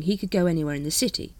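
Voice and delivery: ordinary delivery, nothing marked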